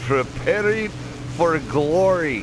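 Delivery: high, slow mock-Russian declaration; fake Russian accent. Gamer joking before a risky bombing run, fake Russian accent. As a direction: put on a hammy Russian accent. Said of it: "that's my fake Russian accent".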